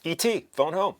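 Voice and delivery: high, loud jokey alien croak; E.T. impression. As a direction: toss off a quick E.T. line. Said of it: "The pull of home".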